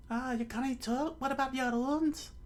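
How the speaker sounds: concerned high falsetto; high young woman, surprised; Nami. Acted. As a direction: ask worriedly in a put-on female voice. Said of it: "Nami said".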